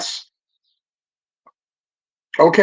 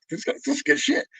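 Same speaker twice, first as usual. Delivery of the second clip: put-on higher, softer, awed little-kid voice; kid's excited quote; 9 semitones higher, 7 dB quieter than usual. Little kid discovering Ratt, higher awed voice. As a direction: gush like a kid hearing his favorite band.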